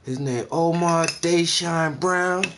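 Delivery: slow, loud, high put-on voice; daughter announcing boyfriend. Daughter telling ma her boyfriend's name, slow put-on announcement. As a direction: draw the full name out proudly, high and loud.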